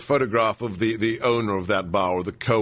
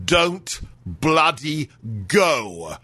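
a slowed-down, hammed-up rant line; exasperated outburst; 3 semitones higher, much slower than usual. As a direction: spit out each word in disbelief. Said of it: "I let myself go a little bit there".

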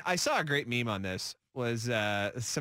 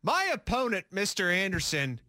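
mock debater; 7 semitones higher than usual. Mock debate attack on co-host.

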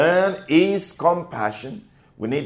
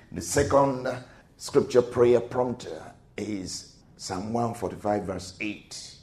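ordinary talk, nothing marked

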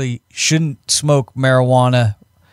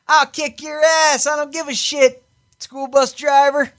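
put-on belligerent kid; 12 semitones higher than usual. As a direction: sneer like a tough little kid. Kid taunting driver.

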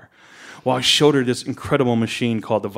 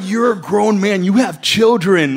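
slow, raised, incredulous telling-off; mock scolding; 7 semitones higher, 5 dB louder, slower than usual. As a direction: scold a grown man in comic disbelief, drawn out. Mock scolding of swearing grown man.

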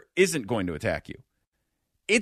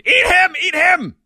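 high, loud panicked scream; 14 semitones higher, 8 dB louder than usual. Retold or imitated. Friend shoved toward the bear, screaming eat him. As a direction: shriek it at the bear to attack your friend. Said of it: "shove them to the ground and screamed".

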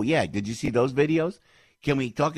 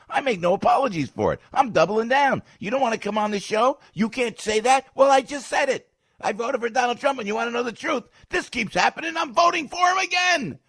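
high, loud, building to a shout; defiant shouting; 11 semitones higher, 5 dB louder than usual. Real, not acted. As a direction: double down defiantly, louder and higher to the end. Defiant rant about his vote.

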